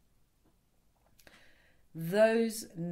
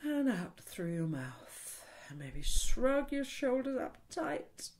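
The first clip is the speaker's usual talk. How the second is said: guided breathing; slower than usual. Shown as a demonstration. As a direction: ease them through the breath, slowly.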